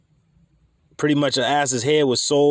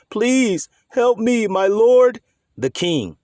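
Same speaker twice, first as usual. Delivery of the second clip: high, slow plea of a desperate woman; pleading woman; 9 semitones higher, 4 dB louder, much slower than usual. Acted. Starving woman calls to the king from below, high pleading. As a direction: beg the king in a high drawn-out voice. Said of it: "a woman called to him".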